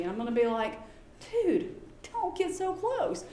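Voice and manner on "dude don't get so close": exasperated warning. Retold or imitated. Warning teacher, retold.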